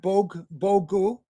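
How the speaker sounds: Bog and Bogu said slowly in a Slavic way, with a short round o; Bog, Bogu spoken slowly as Slavic words; Slavic pronunciation. Shown as a demonstration. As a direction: slowly model Bog, then Bogu, like a language lesson. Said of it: "I can say".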